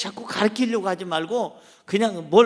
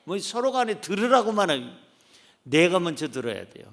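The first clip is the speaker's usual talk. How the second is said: ordinary delivery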